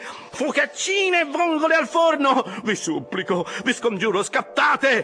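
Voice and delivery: high, frantic begging of the crew; excited, pleading cries. A narrator's reading. Officer hopping about, begging his rowers to speed up, crying high. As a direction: implore them, pitch leaping up on each cry. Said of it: "gridava Flask saltando su e giù".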